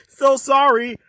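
ordinary talk, nothing marked

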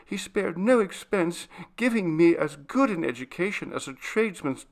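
put-on high, pleading girl's voice; respectful maid protesting; timid servant girl, earnest.

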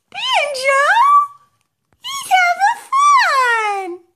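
high, loud delighted shout, drawn out slow; real excited squeal, high and loud; excited shriek. Real, not acted. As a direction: shriek with joy at the dog. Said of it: "Mommy gets a little excited".

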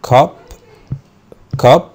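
cup said clearly alone; model word. Shown as a demonstration. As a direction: present cup plainly and clearly. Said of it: "الكلمة اللي بعد كده".

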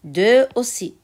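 ordinary talk, nothing marked

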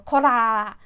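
tongue-rolled 「こら！」 shouted loud. Shown as a demonstration. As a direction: roll the r in 「こら！」 and shout it. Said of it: "その巻き舌音があるんじゃない？".

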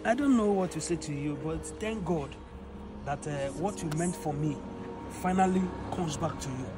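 restrained, softly spoken disappointment; hurt resignation. Acted. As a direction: let the betrayal sink in, quietly. Betrayed boss answers the confessing mechanic.

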